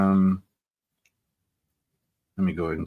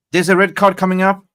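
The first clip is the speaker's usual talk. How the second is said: breaking news on the pitch, getting louder; excited announcing; 9 semitones higher, 7 dB louder than usual. Real, not acted. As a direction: voice climbing higher and louder as the red card comes. Live red card reaction.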